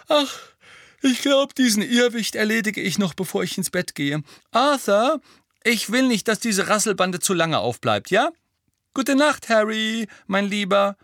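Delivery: Molly voiced high and bustling, bidding goodnight; a high, fussing mother saying goodnight. Mrs Weasley to Arthur and Harry, high and motherly.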